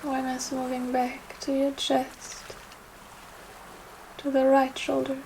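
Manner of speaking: unhurried yoga nidra guidance with long pauses; guided meditation. Acted. Yoga teacher guiding listeners through Yoga Nidra for trust, meditative. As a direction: guide them gently, phrase by phrase, leaving space.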